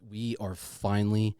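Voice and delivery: ordinary delivery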